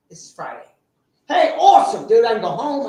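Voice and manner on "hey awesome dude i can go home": excited. Retold or imitated. Excited about going home.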